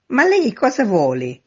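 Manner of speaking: high, loud demanding question; calling down. A narrator's reading. First-floor neighbour looks down at stranger, calling loudly. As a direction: demand it down from a window. Neighbour challenges man from window.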